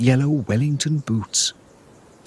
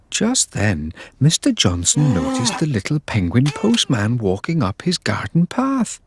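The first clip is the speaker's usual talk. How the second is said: ordinary delivery, nothing marked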